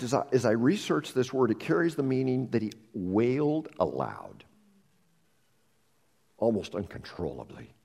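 ordinary talk

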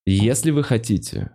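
ordinary delivery

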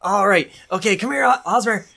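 high Sorrel calling. Acted. Sorrel coaxing Osmer off the road, high call. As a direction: chirp for the animal to come.

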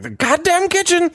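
"The goddamn kitchen" barked high and loud; angry president. Retold or imitated. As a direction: pitch "goddamn kitchen" way up in mock fury. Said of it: "he was getting mad at me".

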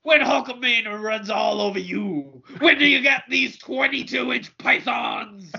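high, shouted wrestler promo; wrestling promo shout. Retold or imitated.

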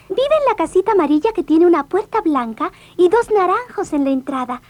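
put-on high, innocent little girl. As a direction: chirp it out like a trusting child. Girl answers wolf.

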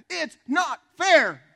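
high, drawn-out yell of protest; yelling child. Retold or imitated. Frustrated six-year-old to his dad at dinner, yelling. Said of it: "he yelled at his dad".

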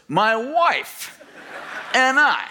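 high plaintive complaint, half laughing; friend's retelling. Retold or imitated. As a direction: whine it out, a bit put-upon. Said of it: "And my friend goes".

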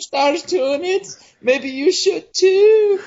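slow, very high falsetto; hammed-up singsong nanny pitch; Missus Doubtfire. Selling drink like an ad, in a slow high nanny voice. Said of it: "I'm Missus Doubtfire".

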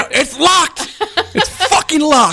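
high, strained comic yelling; mock panicked yell. Joking about prying an unlocked door, mock frantic yell. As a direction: cry out high, hamming up the frustration. Acting out struggle with jammed door.

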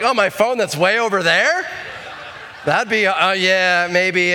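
squirm through a weak excuse; guilty laugh. Retold or imitated. Caught driver dodging the cop's question, laughing.

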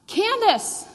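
high, loud call down a crowded bus. Retold or imitated. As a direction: shout for your missing daughter. Father calls for missing daughter.

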